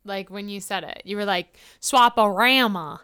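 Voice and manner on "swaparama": "Swaparama" drawn out slowly with a flat A; exaggerated Chicago accent. Imitating a friend's Chicago accent, slow exaggerated "Swaparama". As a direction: stretch the RAM in "Swaparama". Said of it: "exaggerated saying that just to say it".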